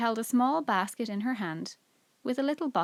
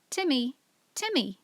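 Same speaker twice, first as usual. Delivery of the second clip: calling Timmy, louder and higher; shouting for the dog; 5 semitones higher, 3 dB louder than usual. A narrator's reading. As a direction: call the dog sharply across the garden. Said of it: "shouted George".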